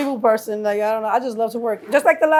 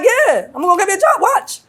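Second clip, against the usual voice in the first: high, loud mock-confident promise; defiant boast; 8 semitones higher, 7 dB louder than usual. Telling the laughing crowd she'll get a job, loud and defiant. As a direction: announce it loud like a dare. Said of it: "I'm like".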